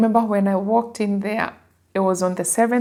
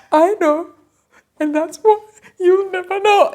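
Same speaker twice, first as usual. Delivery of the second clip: choked-up joy, voice pitched higher and louder; overwhelmed, high and loud; 11 semitones higher, 5 dB louder than usual. Retold or imitated. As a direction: blurt it out, overwhelmed with happiness. Retelling her tearful reply to recruiter.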